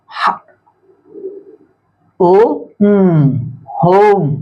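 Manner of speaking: each sound alone, then "होम"; phonics-style build-up to "होम" with pauses. Shown as a demonstration. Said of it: "अब यहाँ इसको पढ़ के देखते हैं".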